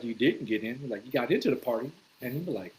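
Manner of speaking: normal talking voice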